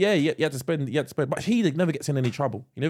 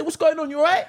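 friendly street greeting; 13 semitones higher, 3 dB louder than usual. Retold or imitated. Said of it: "I just say".

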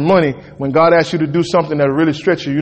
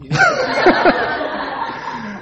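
high laugh. Real, not acted. Laughing at the joke.

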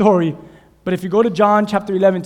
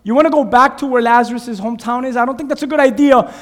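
high, loud anxious pleading; fearful disciples; 8 semitones higher, 9 dB louder than usual. Acted. Disciples begging Jesus not to return, frightened and loud. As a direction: warn him off, voice rising in alarm. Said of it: "his disciples begin to tell him".

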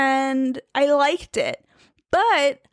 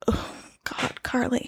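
whisper; 6 dB quieter than usual. Real, not acted. Fumbling the read, mutters to herself.

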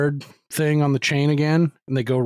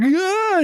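high-pitched mock yelp of panic; sudden high scream, imitating a man freaking out; Upham-style panicked cry; 4 dB louder than usual. Man panicking in a haunted basement, high mock scream. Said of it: "goes full fucking Upham and is like".

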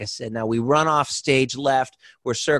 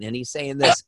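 usual speaking voice

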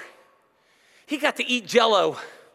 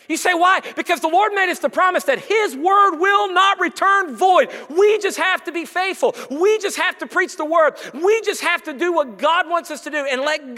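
loud, higher, slow preaching; fiery preaching; 6 semitones higher, 4 dB louder than usual. Acted. Pastor urges the church to stay faithful, preaching hard. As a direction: preach it with full force.

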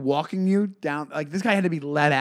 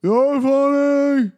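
loud, high, drawn-out heckle; mock heckler's holler, stretched slow; imitated heckler; 10 semitones higher, 8 dB louder, much slower than usual. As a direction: call it out long and loud like a drunk audience member. Imitating drunk heckler's call.

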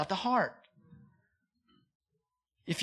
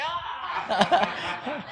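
mock startled cry; 7 semitones higher than usual. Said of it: "And I was like".

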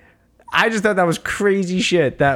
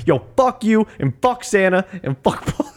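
imitated mouthy kid, pitched up; mock trash talk; 6 semitones higher than usual. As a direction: hurl insults in play, breaking into a laugh. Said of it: "he was just talking s-, dumb shit".

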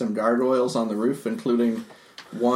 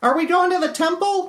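high character voice; 3 dB louder than usual. Acted. S0 voicing Modron character.

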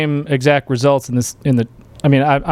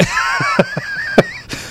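high shrieking laugh; 4 dB quieter than usual. Real, not acted. Co-host cracks up at news.

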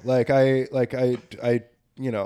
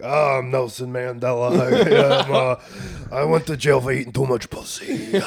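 different voice. Pretend. Comic offering friends a Mandela bit in a different, laughing put-on voice. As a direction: joke voice breaking into laughter. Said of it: "like, a different voice".

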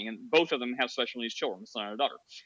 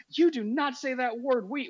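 mock indignant scolding; 9 semitones higher than usual. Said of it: "this woman ... loses it".

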